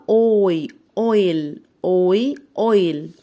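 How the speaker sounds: أويل built up from أوي, clearly; blending sounds. Shown as a demonstration. Teacher blends أويل for pupils, clearly. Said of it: "بصوا يلا".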